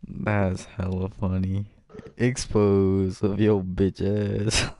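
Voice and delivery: ordinary delivery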